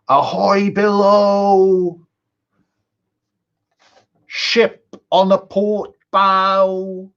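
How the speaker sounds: slow, high, far-off lookout call; shouting from crow's nest. Acted. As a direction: bellow it down from the masthead. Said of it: "a shout from the crow's nest".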